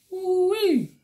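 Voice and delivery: high falsetto woo-wee; high exclamation. Real, not acted. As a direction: whoop it up high. Exclaiming relief.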